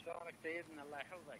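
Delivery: faint, distant phone voice; bad phone line. Real, not acted. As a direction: caller on a weak line. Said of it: "صوتك غير واضح [name]".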